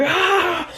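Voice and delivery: high strained yell; hammed-up anime strain cry; mock power-up yell. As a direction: push through the block with a cry. Villain powering up.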